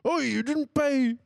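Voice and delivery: high, loud, slow shouting driver; angry bus driver. Retold or imitated. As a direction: bark it like an outraged driver. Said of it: "[name]'s angry bus driver voice".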